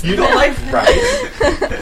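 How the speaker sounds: very high, slow, laughing disbelief; shrill shocked squeal. Pretend. Mock shocked echo, laughing.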